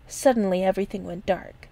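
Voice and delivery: ordinary delivery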